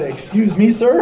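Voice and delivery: high, loud mock disbelief; taken aback. Chaplain answers his warden's surprising call, mock incredulous. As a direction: play up the startled question, rising sharply.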